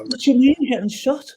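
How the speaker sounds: imitating his wife's high alarm; wife on the phone, shocked high pitch.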